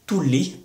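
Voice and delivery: "tulli" with short u and long held l; double L. Shown as a demonstration. Teacher contrasting Finnish consonant lengths, doubled l in "tulli". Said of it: "avec un U et deux L".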